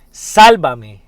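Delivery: a loud cry for help that drops away; plea shouted on the first word only; cried out. Acted. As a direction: call out the first word hard, let the second fall. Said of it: "gritó".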